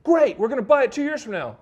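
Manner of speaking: loud, high mock celebration; mock triumph. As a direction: exclaim it like a triumphant buyer. Acts out triumphant annuity buying.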